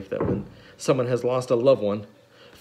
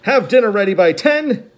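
high, loud, clipped order; Olaf's order; 10 semitones higher, 9 dB louder than usual. A narrator's reading. Olaf ordering dinner by ten, loud high villain voice. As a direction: bark the order. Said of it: "his note read".

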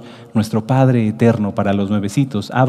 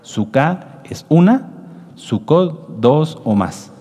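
"Sukkah" for one, "Sukkot" for two or more, drawn out slowly; slow teaching contrast; 3 semitones higher, much slower than usual. Shown as a demonstration. A teacher explaining singular and plural to the congregation, slow. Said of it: "Sukkah es el singular de Sukkot".

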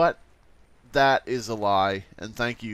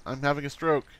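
ordinary delivery

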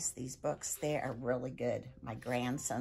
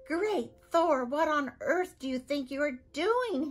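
loud, much higher outraged shout; 10 semitones higher, 7 dB louder than usual. Acted. Viking father catching his son doing dishes, shouting. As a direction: roar in outrage at the sight. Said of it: "Harald shouted".

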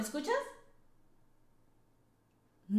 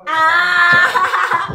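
very loud, drawn-out "Ah!"; loud exclaimed shriek; 2 semitones higher, 13 dB louder than usual. Real, not acted. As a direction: cry out big in amused reaction. Bursts out at her bold answer.

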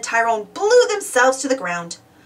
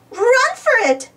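high, urgent yell to flee; 14 semitones higher, 4 dB louder, slower than usual. A narrator's reading. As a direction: call out sharply in alarm, pitched up. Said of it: "yelled Gardener Uniqua".

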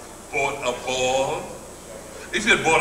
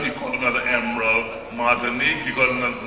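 ordinary delivery

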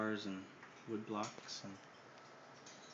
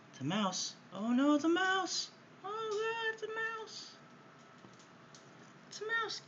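very high, squealing mock alarm; mock-alarmed pet talk. Real, not acted. Owner playfully alarming her bird about a toy mouse, squealing. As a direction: gasp it out as if the toy mouse were real.

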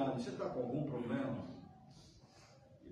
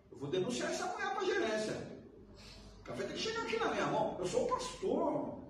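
indignant pastor; 12 semitones higher than usual. Retold or imitated. Indignant pastor voiced in story.

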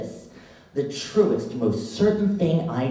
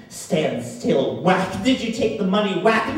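shouting, louder and higher with each blow; father's furious shout; 6 semitones higher, 5 dB louder than usual. Retold or imitated. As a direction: yell it like a father beating his son. Father beating son again.

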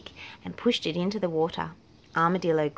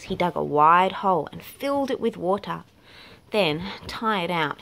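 usual speaking voice

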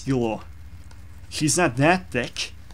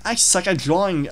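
normal talking voice

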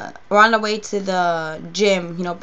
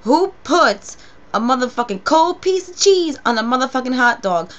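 higher, louder imitated outrage; outraged friend; 5 semitones higher, 5 dB louder than usual. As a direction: spit it out as the furious friend. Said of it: "And she goes".

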